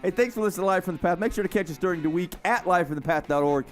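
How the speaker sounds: ordinary talk, nothing marked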